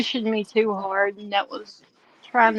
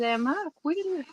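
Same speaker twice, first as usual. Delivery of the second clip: retold unsure question; 6 semitones higher, 7 dB quieter, faster than usual. Asks Danny if she's a quitter.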